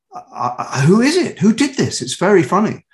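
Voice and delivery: imitating a delighted puzzled fan; amazed fan. Retelling amazed online viewers.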